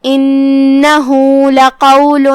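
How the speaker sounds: انہ with the hu drawn out for two counts; slow chanted انہ لقول; two-count sila. Shown as a demonstration. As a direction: hold the vowel of انہ for two beats. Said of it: "ہم اپنی آواز دو مد کے برابر لمبی کریں گے".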